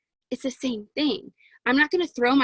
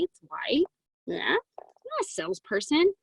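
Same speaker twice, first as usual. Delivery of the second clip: higher, playful mock airhead voice; playful ditzy; 5 semitones higher, 3 dB quieter than usual. Telling prospects she is no salesperson, mock airhead voice. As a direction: play the clueless car-lot shopper lightly. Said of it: "I say".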